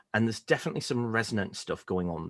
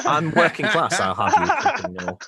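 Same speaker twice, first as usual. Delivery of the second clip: mock indignant; 5 semitones higher, 6 dB louder than usual.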